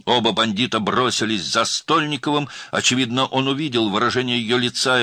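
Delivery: high, loud, urgent storytelling; tense action narration. A narrator's reading. Narrator telling of girl's flight, urgent. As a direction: drive the chase forward breathlessly.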